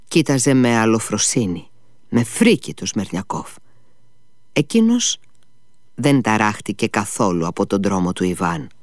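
ordinary delivery, nothing marked

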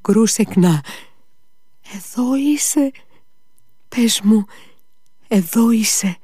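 high, pleading call into the dark. Acted. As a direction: call to her, hoping she's there. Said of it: "Το φώναξε αυτό".

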